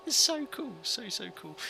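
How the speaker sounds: gushing, high-pitched delight; excited. Real, not acted. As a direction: squeal it out with delight. Gushing over a sim feature.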